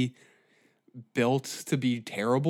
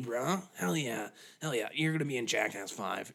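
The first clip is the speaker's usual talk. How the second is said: ordinary talk, nothing marked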